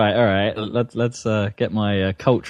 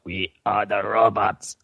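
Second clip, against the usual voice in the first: slow, even robot voice; lightly voiced vocoder chant; 3 semitones lower, 3 dB quieter, slower than usual. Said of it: "they vocode".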